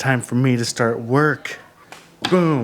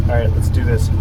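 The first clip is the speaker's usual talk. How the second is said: louder, goofy playful voice; silly clown; 5 dB louder, much faster than usual. Acted. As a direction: ham it up for the kid. Playing with child on ride.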